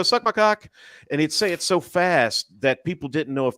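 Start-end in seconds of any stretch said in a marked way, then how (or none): 0.0-0.7 high, quick, bright-sounding insult; rapid insult as greeting. Retold or imitated. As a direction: toss it off like a cheery hi. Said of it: "he'd go".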